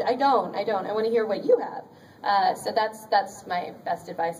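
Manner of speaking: usual speaking voice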